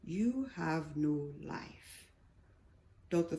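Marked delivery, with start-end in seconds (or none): 0.0-2.1 soft, slow small voice; a barely-there inner voice, slowly; the small voice repeats it softly. A narrator's reading. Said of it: "Small voice".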